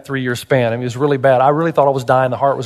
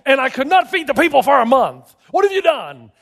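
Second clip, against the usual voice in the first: high, exasperated-sounding protest; acted protest; 8 semitones higher than usual. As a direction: argue back at God, pitch climbing. Moses protesting to God in story.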